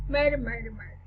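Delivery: usual speaking voice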